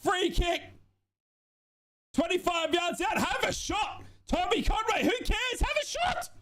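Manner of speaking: high, pleading, shouting at the screen; frantic commentary. Real, not acted. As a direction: beg them to shoot, voice cracking high. Live watchalong, late free kick tension.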